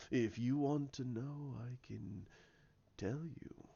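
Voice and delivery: soft, coaxing villain voice; Snoke. Retold or imitated. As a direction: slowly tempt in a hushed voice. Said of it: "He goes".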